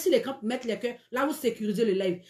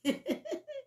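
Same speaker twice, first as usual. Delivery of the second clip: high laugh; 14 semitones higher, 8 dB quieter than usual. Real, not acted. Host laughs at a viewer comment.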